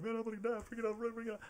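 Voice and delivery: hammed-up freak-out in a very high squeal; squealing stoner panic; Cheech. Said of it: "And he's freaking out".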